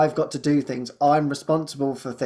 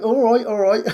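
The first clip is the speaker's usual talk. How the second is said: mock exasperated; 6 semitones higher, 3 dB louder than usual. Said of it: "I'm like".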